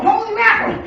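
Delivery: high, loud shocked yelp; shocked exclamation. Acted. Fishmonger reacts to the smell with a loud shocked yelp.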